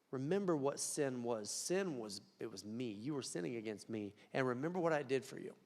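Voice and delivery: tender Jesus. Acted. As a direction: speak it like a loving plea, softly. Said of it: "Jesus voiced tenderly".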